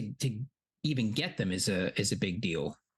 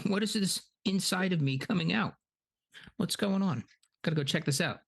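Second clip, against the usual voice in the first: mock confused monster; 8 semitones higher, faster than usual. Shelob voiced, confused.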